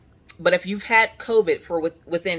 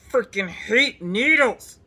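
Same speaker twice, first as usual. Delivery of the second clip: drawn-out whine; 5 semitones higher, much slower than usual. Retold or imitated. Complaining about needles.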